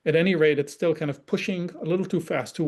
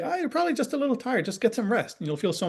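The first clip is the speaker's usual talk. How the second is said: high, fast offhand reassurance; brushing off the upset kid; mock breezy parent; 7 semitones higher than usual. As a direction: toss it off like a know-it-all parent. Parent voices a dismissive parent.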